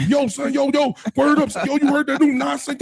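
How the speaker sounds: high, hyped New York dude voice; East Coast accent. Retold or imitated. A Southern guy imitating the New York friend Jamal in a high New York accent. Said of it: "the first East Coast dude I met".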